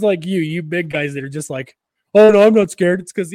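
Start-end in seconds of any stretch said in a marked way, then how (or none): 2.1-3.0 higher, louder mock bravado; mock tough guy. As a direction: ham up a big guy's boast. Mocking big guys' bravado.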